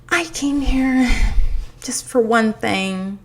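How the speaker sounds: high, drawn-out imitation of a line-cutter; mock pleading. Line-cutter pleads her one-item case to shopper, mock pleading. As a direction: plead slowly, putting on another woman's voice.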